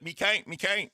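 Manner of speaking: high, repeated Irish leprechaun "Me canked"; leprechaun Irish accent. Retold or imitated. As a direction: chirp "Me canked, me canked" like a little leprechaun. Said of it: "I was like".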